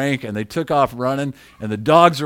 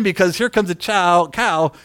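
ordinary talk, nothing marked

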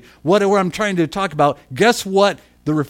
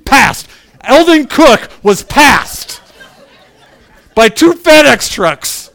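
slow, loud, higher mock outrage; mock indignant shout; 7 semitones higher, 8 dB louder, slower than usual. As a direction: preach the insult of being passed, slowly. Mock outrage at being passed.

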